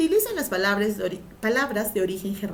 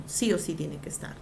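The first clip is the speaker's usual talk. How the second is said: ordinary talk, nothing marked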